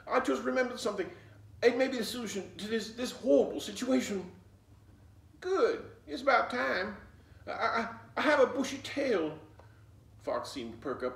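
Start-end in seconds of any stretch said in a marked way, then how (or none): 0.1-4.3 high, loud and fast excited babble; frantic rush. Acted. Fox blurts his idea to Flossie, high, loud and fast. 5.4-9.4 high, loud eager stammer; excited stammer. Acted. Fox eagerly tells Flossie, high and loud with a stammer.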